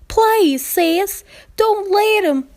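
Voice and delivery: high, loud desperate begging; high, loud frantic plea; pleading. Acted. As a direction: beg your big sister, near tears. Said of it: "looking up to her with pleading eyes".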